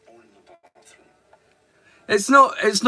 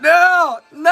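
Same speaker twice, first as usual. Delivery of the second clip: loud high no; shout; 9 semitones higher, 6 dB louder than usual. Real, not acted. Streamer yelling no at chat. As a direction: yell it out in protest.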